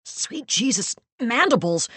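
high, frantic cry; panicked. Acted. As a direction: voice cracking with fear. Panicking narrator voicing victim.

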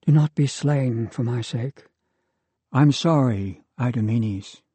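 usual speaking voice

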